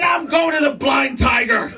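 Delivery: high, comic yell to end the fight; hammed-up husband retort; defiant shout back. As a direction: storm out shouting. Husband yells back in quarrel.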